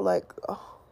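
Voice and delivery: usual speaking voice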